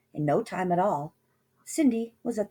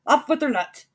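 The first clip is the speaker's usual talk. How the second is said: higher, louder repeated order; higher, loud furious repeat; higher shout; 8 semitones higher, 6 dB louder than usual. A narrator's reading. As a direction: shout it again even harder. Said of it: "The prince cried".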